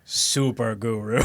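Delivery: slow, drawn-out parody guru voice. Retold or imitated. Guru correcting his new title, slow imitated character voice. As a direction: stretch the title out grandly. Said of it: "the voice that he uses".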